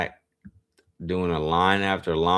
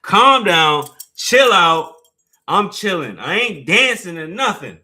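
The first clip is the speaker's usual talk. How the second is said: much louder, higher "chill out"; loud high exasperated shout; retold yell; 7 semitones higher, 10 dB louder than usual. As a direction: yell at the pushy birthday guy to back off. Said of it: "I was like".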